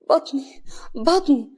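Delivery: high, loud cry of pain; tearful pain. A narrator's reading. Pregnant wife struck by her husband, tearful pain. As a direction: cry out tearfully, clutching at the hurt. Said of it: "كريمة بدموع".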